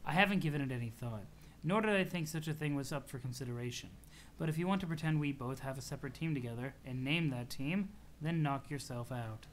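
dry and understated, humouring them; lightly voiced deadpan Aradia. Aradia humouring a team-name idea, lightly deadpan.

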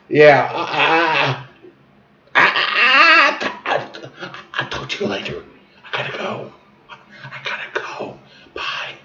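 feeble, breath-only sign-off; faking sick. Retold or imitated. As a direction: stammer and fade to a whisper. Faked sick call fading out.

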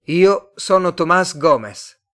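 loud, slow and high; slow, forceful announcement of a name; emphatic self-introduction. A narrator's reading. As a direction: spell it out for a foreigner. Introduces himself to uncomprehending foreigner.

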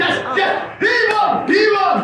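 eager agreeing shout. Acted. Courtier echoing loud support.

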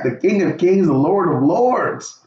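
normal talking voice

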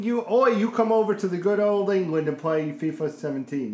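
a loud, hammed-up English accent, pitched high; a lousy British accent that doesn't commit until the end; bad English accent. Mocking a British gamer in a loud, hammed-up English accent. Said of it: "That was a terrible accent".

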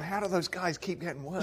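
high, whiny mock complaint; hammed-up griping of a sleepless rival; mock indignant whine.

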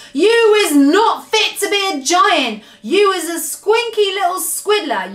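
high, loud angry tirade; shouted insults. A narrator's reading. Giant berates the BFG, shouting. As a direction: hurl the insults at high pitch. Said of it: "shouted the blood bottler".

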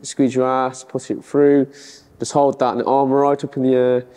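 ordinary delivery

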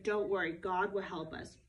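steady him like a gentle leader; calm Moses. Acted. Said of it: "And Moses said".

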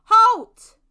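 very loud, high shouted command; sharp high shout to stop someone; calling out. A narrator's reading. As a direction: bellow it as a soldier's order. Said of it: "they called out".

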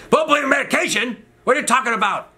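very high, incredulous mock outburst; imitated outraged doctor. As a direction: screech back like an affronted doctor. Doctor outraged at patient's remark.

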